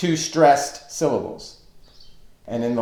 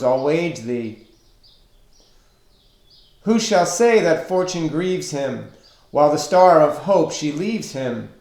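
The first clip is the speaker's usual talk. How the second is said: higher, ringing metrical reading with stressed line openings; formal poem recitation; 4 semitones higher, 4 dB louder than usual. Acted. Teacher reads Burns farewell poem aloud to class, formal recitation. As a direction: declaim the verse in measured, emphatic beats. I'll read it for you.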